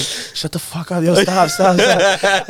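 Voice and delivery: loud exasperated stop; scolding shout. Retold or imitated. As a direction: yell at him to stop. Girlfriend scolding him.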